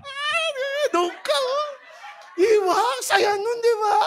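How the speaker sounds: high, squealing pretend delight; mock excitement. Speaker imagining an audience member's name appearing, playfully thrilled. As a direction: squeal it with a laugh breaking through.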